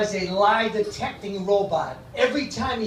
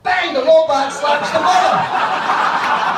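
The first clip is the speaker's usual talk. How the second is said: loud, high, drawn-out joke payoff; punchline shout; 7 semitones higher, 8 dB louder, much slower than usual. Acted. Comic lands robot joke for the crowd, punchline shout. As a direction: belt out the punchline, stretching it.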